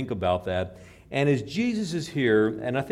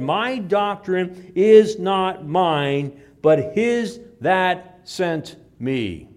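very slow, louder, solemn declaration; solemn Jesus quote; 5 semitones higher, 6 dB louder, much slower than usual. Retold or imitated. Preacher voicing Jesus to the crowd, slow solemn proclamation. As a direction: proclaim it slowly like Jesus answering.

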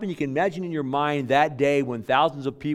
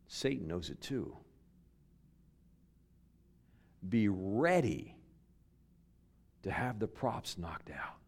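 hushed warning; 5 semitones lower, 9 dB quieter than usual. Real, not acted. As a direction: drop to a soft whisper. Warning about Satan.

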